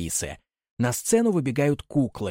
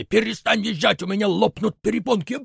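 stamping anger; 10 semitones higher, 4 dB louder than usual. Acted. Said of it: "топает ногами".

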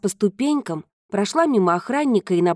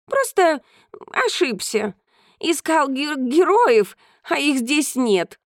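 slow, slightly higher cat voice; a hint of a proud cat; lightly voiced cat; 6 semitones higher, slower than usual. Cat speaking.

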